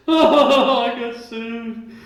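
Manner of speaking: high, slow wail with laughter; mock panic. Sued person panicking over court papers, mock wail. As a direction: whine it like a panicked victim. Mimicking people who get sued.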